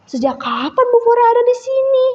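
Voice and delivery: high-pitched surprised question; startled. A narrator's reading. Kagau turns round to find Bu Fora there, startled. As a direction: ask it high, caught off guard. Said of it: "Kagau langsung berbalik kaget".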